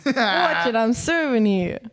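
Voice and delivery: put-on slow New York tennis-player accent; New Yorker at Niagara Falls playing tennis, in a New York accent. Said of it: "you're a New Yorker".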